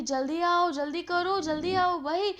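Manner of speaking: high-pitched, urgent mock call; imitated shouting. Bird urges robbers to come quickly, in imitated high shout. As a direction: act out someone calling for help to hurry. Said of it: "कोई चिल्ला रहा है और चिल्लाते चिल्लाते".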